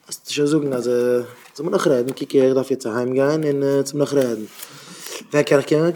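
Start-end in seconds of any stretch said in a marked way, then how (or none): none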